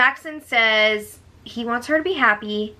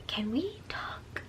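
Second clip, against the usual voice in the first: slow whispered plea, near tears; upset whisper; 16 dB quieter, slower than usual. Retold or imitated. Holly asking Jackson to talk, retold whisper. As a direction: breathe it out softly, hurt. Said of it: "she is sounding so upset".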